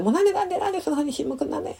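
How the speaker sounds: high, very fast frustrated rattle; exasperated rant. Retold or imitated. Mother losing patience with her silent son, rapid high rant. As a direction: fire it off, fed up with the silence. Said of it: "みたいな".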